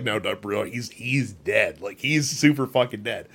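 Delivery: a hint of a bored game narrator; deadpan game voice. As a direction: tell him he is dead, no debate. Game denies the revive.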